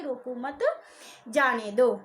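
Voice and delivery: रोको मत as one phrase, long pause, then जाने दो; stress on मत. Shown as a demonstration. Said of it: "मत पर बलाघात कर दिया".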